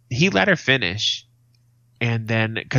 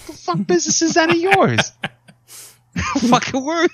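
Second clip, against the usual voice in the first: high, loud outraged snap; hammed-up indignant yell; angry retort; 5 dB louder than usual. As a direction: bark it at the nosy stranger.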